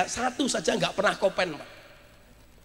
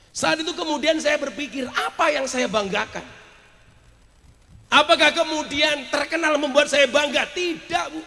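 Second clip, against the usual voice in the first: raised, forceful rhetorical questioning; impassioned preaching; 8 semitones higher, 5 dB louder than usual. Real, not acted. Preacher questioning his pride in fame, impassioned preaching. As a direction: ring out each question to the crowd.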